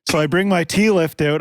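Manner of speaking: usual speaking voice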